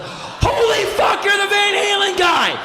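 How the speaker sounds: very high, slow, full-out yell; starstruck yell. Retold or imitated. Fan realising he's met the [name] guy, starstruck scream. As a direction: scream it out in stunned fan amazement.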